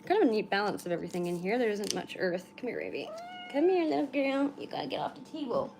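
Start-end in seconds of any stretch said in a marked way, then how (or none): 2.6-5.7 pet talk. Real, not acted. Talking to cat on table.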